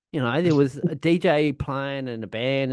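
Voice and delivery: normal talking voice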